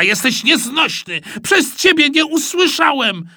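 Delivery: high, loud scolding from dad; exasperated shout. A narrator's reading. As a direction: blame the boy at full pitch. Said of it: "krzyknął tata". (rozdrażniony krzyk taty)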